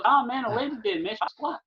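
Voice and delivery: high, put-on voice; mimicking a high-voiced coworker; janitor's comic reply. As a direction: answer in a squeaky mock protest. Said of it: "he's like".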